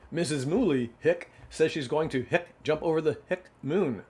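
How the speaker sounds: telling it between hiccups; hiccuping horse. A narrator's reading. Horse tells the pig while hiccuping. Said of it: "got the hiccups".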